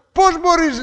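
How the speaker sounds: high, reproachful question; a peer shaming the one who is different; mock reproach. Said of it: "λέει".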